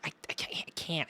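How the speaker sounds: ordinary delivery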